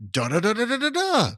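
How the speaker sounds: high, singsong announcer melody; mock cartoon announcer. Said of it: "he would say these two titles".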